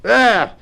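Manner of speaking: spitting out a foul taste; disgusted ugh. Acted. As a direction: groan at the taste. Paint in his mouth.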